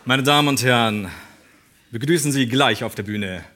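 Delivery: mock-epic stage introduction, swelling; grand entrance announcement. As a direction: announce him like a boxing champ.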